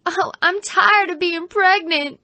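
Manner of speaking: high, put-on whining stage voice; hammed-up complaint from the hospital bed; whiny actress.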